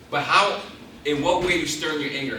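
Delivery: usual speaking voice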